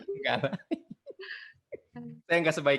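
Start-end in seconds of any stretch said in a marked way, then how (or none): 0.0-0.6 laughing. Real, not acted. Laughs off his own threat.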